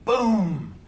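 long drawn-out boom; a slow, booming gunshot imitation; imitated gunshot boom. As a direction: stretch out a mock gunshot bang. Imitating the gunshot in his story.

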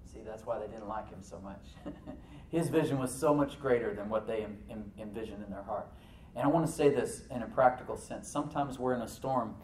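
normal talking voice

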